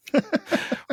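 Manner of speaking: high squealing burst of laughter; real laughing squeal. Real, not acted. Cracking up at the newspaper text.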